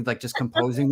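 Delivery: ordinary delivery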